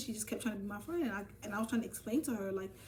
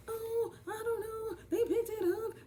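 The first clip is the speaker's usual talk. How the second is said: mocking the friend; 13 semitones higher than usual. Friend making excuses about the book, mocking high voice. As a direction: drag it out in a silly squeaky voice. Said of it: "Like, saying just dumb shit".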